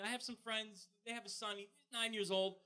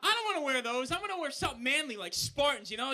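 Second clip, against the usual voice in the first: ordinary delivery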